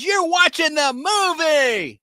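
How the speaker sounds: shocked accusation. Retold or imitated. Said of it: "she goes".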